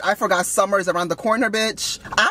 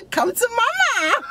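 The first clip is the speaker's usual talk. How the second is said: higher, slower, through a laugh; laughing come to mama; 13 semitones higher, slower than usual. Acted. Home wrecker repeats her offer, cracking up laughing. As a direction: laugh it out, high and drawn out.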